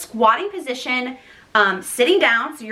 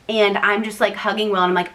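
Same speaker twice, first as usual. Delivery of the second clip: ordinary talk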